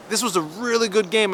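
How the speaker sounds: retold annoyance. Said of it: "I was really pissed".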